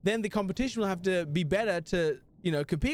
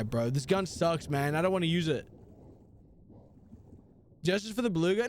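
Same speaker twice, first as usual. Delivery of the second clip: ordinary talk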